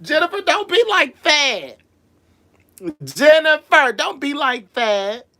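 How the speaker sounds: high, sing-song teasing scold; mock scolding. Host teasing viewer Jennifer with a mock scold. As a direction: playfully scold her.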